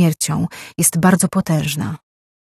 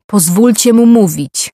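Riaja raises her voice to cut through the chatter; louder and higher, calling over the room; raised over others; 5 semitones higher, 6 dB louder than usual. A narrator's reading. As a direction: let him speak, said over the others' noise. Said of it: "odezwała się Riaja, przekrzykując je".

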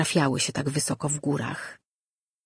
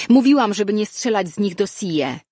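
raised, higher scolding voice; angry calling out; 5 semitones higher, 5 dB louder than usual. A narrator's reading. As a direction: shout a cross reprimand. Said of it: "zawołała".

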